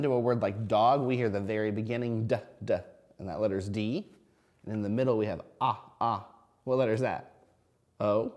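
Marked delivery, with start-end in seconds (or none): 2.3-2.8 "d, d" as a repeated isolated d sound. Shown as a demonstration. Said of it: "I'm stretching out those words". 5.2-6.3 "ah" as a stretched short o vowel; sounding out. Shown as a demonstration. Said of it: "I'm stretching out those words".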